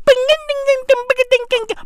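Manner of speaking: high sung ding-ding guitar noises; mock guitar strumming noises; imitated guitar. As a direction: plink out guitar notes with the voice.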